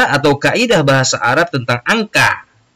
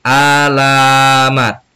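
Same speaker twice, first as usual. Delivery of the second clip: Alamat stretched out slowly and a little louder; slow clear answer; 4 dB louder, much slower than usual. Shown as a demonstration. Teacher giving the meaning to pupils, slow, deliberate. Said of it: "Apa artinya?".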